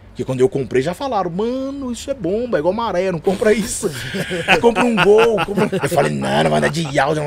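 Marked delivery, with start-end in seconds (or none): 1.3-3.9 mock warning. Family warning him off the car. 4.6-5.7 mock pushy relative. Family pushing a cheaper car.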